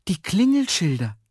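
high cry of discovery; sudden exclamation. A narrator's reading. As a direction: call it out high, struck by an idea. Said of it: "rief Herr Jaromir". (heller Ausruf bei plötzlichem Einfall)

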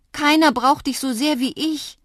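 loud, high, heartfelt plea; urgent appeal. A narrator's reading. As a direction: call out your need to a friend. Child pleads with departing friend. (laut und flehentlich)

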